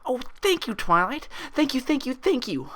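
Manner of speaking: very high, gushing thanks; ecstatic. A narrator's reading. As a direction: gush with relief. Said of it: "she said ecstatically".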